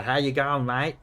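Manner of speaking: heavy Australian bogan accent. Shown as a demonstration. Streamer demonstrating bogan speech to a Dutch viewer, heavy Australian accent. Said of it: "That really heavy Australian".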